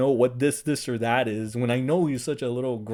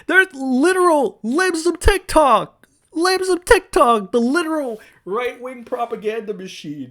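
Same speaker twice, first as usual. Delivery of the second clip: shouting the phrase twice, pitched way up; exasperated rant; 10 semitones higher, 7 dB louder than usual. Real, not acted. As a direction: drag each word out in outrage. Ranting at conservatives.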